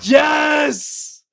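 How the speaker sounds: high, loud shout of joy; excited yes. Real, not acted. To his cohost, an excited shout. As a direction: cheer it out. Excited about a news item.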